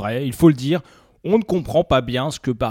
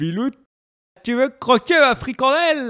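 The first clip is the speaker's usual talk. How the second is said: put-on high northern Ch'ti accent; northern French accent; 8 semitones higher than usual. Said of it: "un coup le nord".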